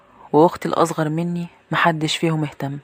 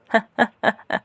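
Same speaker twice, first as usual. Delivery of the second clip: short, higher staccato laugh; mocking laugh; 6 semitones higher than usual. Maid laughs at the girl's threat, mocking staccato laugh.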